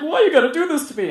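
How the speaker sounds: high, quick mock whine; hurried high-pitched plea; student's complaint. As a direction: imitate a kid protesting, way up high and fast.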